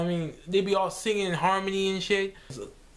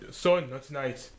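dad voice; 6 semitones lower, slower than usual. Retold or imitated. Dad voiced in commercial skit.

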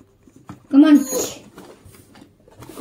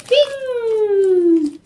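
playful stamp sound; 9 semitones higher than usual. Pretend.

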